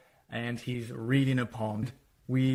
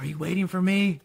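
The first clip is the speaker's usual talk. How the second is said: hopeful; 7 semitones higher, faster than usual. Retold or imitated. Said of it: "and he's like".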